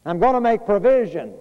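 ringing, high preacher's call of reassurance; loud preaching. Acted. Preacher voicing Jesus to his disciples, loud and ringing. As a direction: preach it out loud to the whole congregation.

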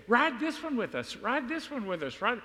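high, mock-whiny begging of young girls; pleading middle school girls. Middle school girls begging their youth minister for a ride. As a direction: plead like a kid tugging a sleeve.

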